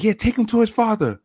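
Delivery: high mocking mom voice; imitated mother. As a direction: toss it off like the mother. Voicing the mother.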